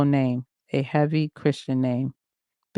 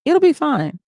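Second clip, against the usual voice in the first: high, loud brush-off; dismissive; 11 semitones higher, 6 dB louder than usual. Acted. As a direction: wave the worry away loudly. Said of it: "He verbally waved me off".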